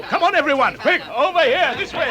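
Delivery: high, loud come-here call; urgent calling. Acted. Dwarf calling the company to the trail, high and urgent. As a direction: hurry everyone over.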